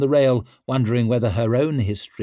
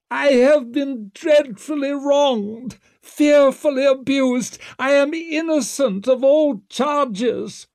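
very high, slow, wronged protest; theatrical high wail to the gallery; shrieking in ringing tones; 5 dB louder, slower than usual. A narrator's reading. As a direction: declaim your innocence in a drawn-out shriek. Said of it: "shrieked Wetterland in ringing tones".

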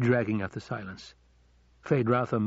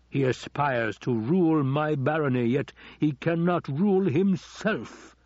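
scornful Baron; 5 semitones higher, 4 dB louder than usual. Acted. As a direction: rise in scorn toward the last word. Baron scorns his nephew.

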